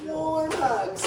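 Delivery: high, bright snowman character voice; Olaf voice. Acted. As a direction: puppet snowman, cheerful and high. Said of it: "Hi, Olaf".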